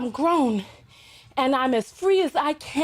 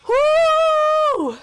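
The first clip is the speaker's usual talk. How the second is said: high loud woo; excited whoop; 12 semitones higher, 6 dB louder than usual. Real, not acted. Host whooping to welcome the viewers. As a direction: let out a big whoop.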